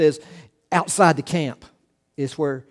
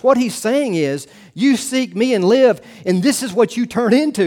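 louder, higher, driving preacher voice; full-force preaching; 4 semitones higher, 5 dB louder than usual. Acted. Preaching climax on commands.

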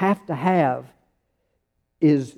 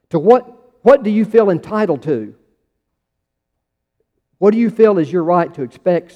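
raised, driving questions at the church; preaching at full force; 2 semitones higher, 6 dB louder than usual. Acted. Pastor reading searching questions to his congregation, preaching hard. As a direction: hammer each question home.

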